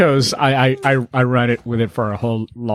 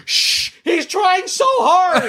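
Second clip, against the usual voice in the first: put-on very high, slow laughing old-lady voice; old lady shushing; much slower than usual. Old lady in front row defending him, high and laughing. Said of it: "The poor old lady".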